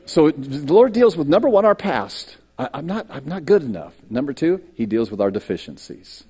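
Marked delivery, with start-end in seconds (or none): none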